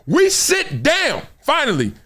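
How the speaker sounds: high and slow, stretched with relief; finally sit, let it out; drawn-out relief. Retold or imitated.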